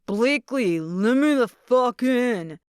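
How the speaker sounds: loud, slow furious shout; drunken yell. Acted. Drunk ex shouting at the door to be let in, furious yell. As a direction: bellow it through the front door. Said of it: "his yelling woke me fully".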